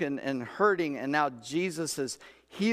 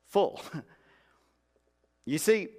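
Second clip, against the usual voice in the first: usual speaking voice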